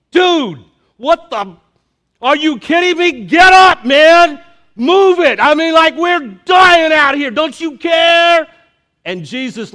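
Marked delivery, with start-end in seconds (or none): 0.1-3.2 high, loud incredulous sailor yell; rough sailor yelling. Retold or imitated. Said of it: "I imagine some rough sailors getting them going". 3.2-8.5 high, loud incredulous sailor yell; rough sailor yelling. Retold or imitated. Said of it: "I imagine some rough sailors getting them going".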